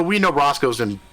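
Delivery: usual speaking voice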